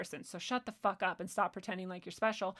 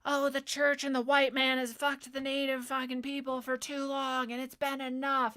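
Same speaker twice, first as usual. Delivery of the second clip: mocking quote; 4 semitones higher than usual. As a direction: parody their outrage without going big. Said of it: "people kept bringing up, like".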